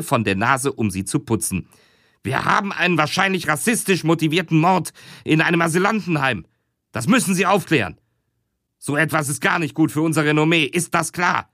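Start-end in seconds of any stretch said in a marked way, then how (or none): 2.2-11.5 higher, fast nervous boss; agitated boss. Acted. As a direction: rush it high like a harried chief. Boss gives orders.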